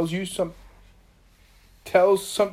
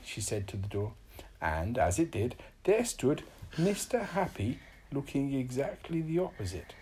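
usual speaking voice

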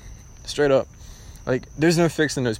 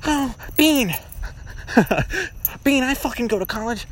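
high mocking whiny voice, laughing through it; high nasal-free mock student whine; mocking college kid; 12 semitones higher than usual. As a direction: sneer at the know-it-all commenter. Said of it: "about to type in the comments".

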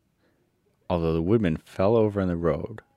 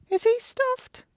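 very high squeaky lion voice, asking; a big coward caught off guard; high squeaky surprised lion; 4 dB louder, slower than usual. A narrator's reading. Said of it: "asked the lion, in surprise".

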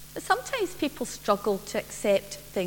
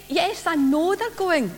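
impatient; 7 semitones higher, 4 dB louder than usual. Acted. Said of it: "Orla muttered impatiently".

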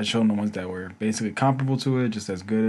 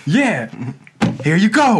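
high, loud friendly cashier; mock cashier; 9 semitones higher, 6 dB louder, slower than usual. Cashier handing over the game: high loud cheerful. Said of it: "cashier handing game over".

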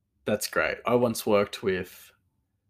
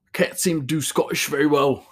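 loud, high Scottish attempt that sounds Welsh; bad Scottish accent; 6 semitones higher, 6 dB louder than usual. Shown as a demonstration. Showing they can't do Scottish, Welsh-sounding accent. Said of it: "it comes off as, like, really Welshy".

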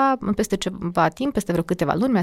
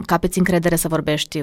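normal talking voice

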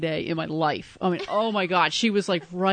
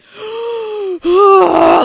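loud, high imitated gasp; starstruck gasp; 13 semitones higher, 12 dB louder than usual. Retelling meeting a celebrity, loud high gasp. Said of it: "And I was like".

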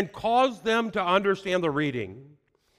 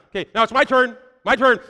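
mock eager prayer; 5 semitones higher, 8 dB louder, faster than usual. Voicing an eager believer at prayer.

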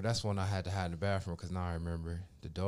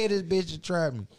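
high loud mock outrage; trapped and outraged about it; 9 semitones higher, 7 dB louder than usual. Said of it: "Oh, Lord, shit".

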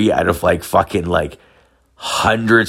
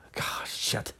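a breathy whispered curse; 17 dB quieter than usual. Retold or imitated. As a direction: whisper it, totally stuck. Continues despair.

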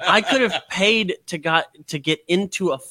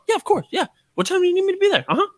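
much higher, eager people-pleaser; eager yes-man; much faster than usual. Retold or imitated. Mocking herself agreeing to anything, high and eager. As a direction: chirp yes to everything, overly eager.

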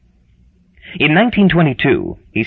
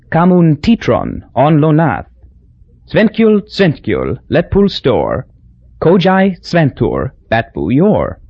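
the rhyme in the San Lorenzo island dialect, higher, with slow "kojai sventur"; 4 semitones higher, slower than usual. Shown as a demonstration. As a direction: recite "Svenskjul, svenskjul" as the island creole, the first "Svenskjul" lifted high. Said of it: "In San Lorenzen dialect, the same poem went like this".

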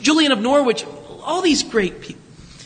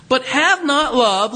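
slow scripture refrain; 3 semitones higher, slower than usual. Acted.

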